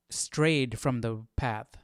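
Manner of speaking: ordinary talk, nothing marked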